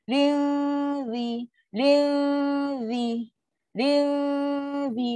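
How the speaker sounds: "ling" drawn out as a hum, then "zi"; held dengung. Shown as a demonstration. Teacher shows students the hum settled before the next letter, held dengung. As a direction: hold the nasal hum in "ling" before touching "zi". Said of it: "Settle dengung dulu baru sentuh huruf".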